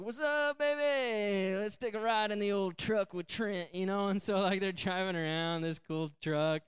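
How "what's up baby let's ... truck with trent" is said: high, hammed-up pickup line; cocky boyfriend. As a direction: swagger it, starting high and sliding down. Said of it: "And he's like".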